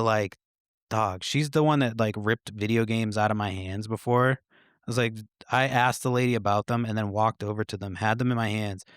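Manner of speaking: usual speaking voice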